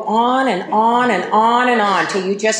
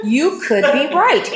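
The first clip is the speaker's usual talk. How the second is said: drawn out, higher, soothing give-in; slow gentle concession; 5 semitones higher than usual. Retold or imitated. Conceding to the husband at the dinner table, gently and slowly. Ending the sidewalk argument at dinner.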